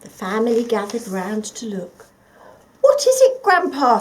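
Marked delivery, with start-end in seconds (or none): 2.8-4.0 put-on much higher, louder eager kids; chorus of curious grandchildren; children's voices. The children ask Grandpa eagerly, high and loud. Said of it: "said the children".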